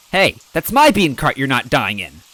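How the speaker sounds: loud, high, indignant cart owner; angry shout. Acted. Bean cart owner shouting at the stranger in his cart. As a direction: yell at the stranger wrecking your cart. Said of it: "someone cried".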